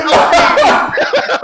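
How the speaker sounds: loud, high burst of laughter. Real, not acted. Friends react to the French question story, loud high laughter. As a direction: crack up loudly, pitched high.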